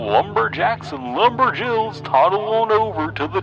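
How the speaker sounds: high, stretched, goofy theme-park announcer; goofy hype announcer. A narrator's reading. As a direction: sell it like a monster truck rally ad, but silly. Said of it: "did a passable goofy impression, mixed with the hype style".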